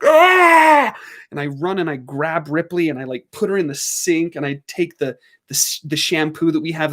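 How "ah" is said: high, loud panicked yell; panicked scream. Retold or imitated. Seeing the mess on her dog, a panicked yell. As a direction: shriek in panic. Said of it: "immediately I'm like".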